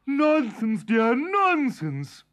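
calm the giant down; wife soothing. A narrator's reading. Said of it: "said his wife".

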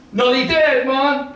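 blurted Jamaican voice. Acted. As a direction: blurt bad news bluntly, high and sudden, Jamaican accent. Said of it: "then the voice came".

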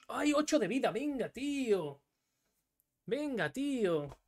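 high-pitched, drawn-out complaint; exasperated protest. Real, not acted. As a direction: whine it in disbelief, stretching the words. Exasperated at losing the game.

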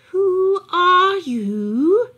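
very slow, high, loud hoot of a question; an old owl peering at a stranger; old owl asking. Acted. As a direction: stretch it out like a wise owl.

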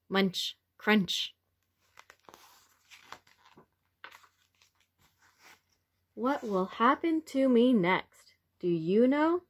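lively, expressive picture-book read-aloud; reading to children. A narrator's reading. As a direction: read it to little ones with wonder.

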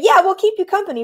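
high, reassuring kangaroo voice; kangaroo camper. Acted. Kangaroo joining the bear, high supportive voice. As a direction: chime in high to offer company. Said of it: "Kangaroo is saying".

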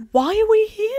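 very high-pitched baffled question; mock bewilderment. Reader baffled by the prison scene, very high mock bewilderment. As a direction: squeak it in exaggerated puzzlement. Own past reaction acted out.

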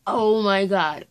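loud, drawn-out disbelief; big reeling reaction; stunned exclamation. Retold or imitated. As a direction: stretch it out slowly, overwhelmed. Stunned reaction to fast plot.

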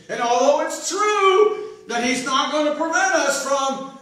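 loud rousing pulpit delivery; full-force preaching. Real, not acted. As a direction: drive it home to the congregation.

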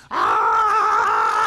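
shrill high scream, very loud; one short terrified shriek; horror movie scream. Acted. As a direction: scream like a victim in a slasher film. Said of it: "Quick scene from every horror movie".

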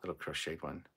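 ordinary delivery

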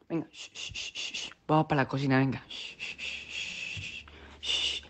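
soft, low murmur with gentle shushes; hushed soothing. Acted. Son carrying the sleeping chicken to the kitchen, hushed. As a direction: keep a sleeper from waking.

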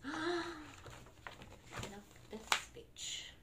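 hushed breathy whisper after a gasp. Pretend. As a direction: whisper it in awe.

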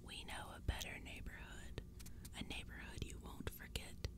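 very soft, eerie creature hiss; hissing whisper. A narrator's reading. Pumpkinhead promising an unforgettable neighborhood, hissing in a whisper. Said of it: "The voice hissed from inside".

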